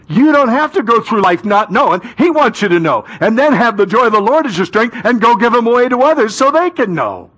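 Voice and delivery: high, fast-building preacher's exhortation; impassioned preaching. Real, not acted. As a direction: preach it at full force, pitch lifted. Preacher builds to full force.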